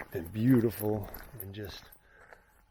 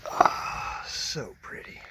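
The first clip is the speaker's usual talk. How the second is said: whisper; 4 semitones higher, 4 dB louder, much slower than usual. Real, not acted. Admiring the rock formations.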